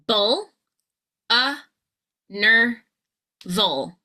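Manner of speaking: isolated syllables with clear pauses between; back chaining. Shown as a demonstration. Coach back-chains the word, one spaced syllable at a time. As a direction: drill each syllable separately, last to first. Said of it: "back chaining".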